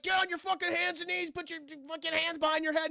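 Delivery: high, urgent acted police commands; yelled orders. Agent holding killer at gunpoint, high yelled orders. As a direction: imitate a frantic agent giving orders.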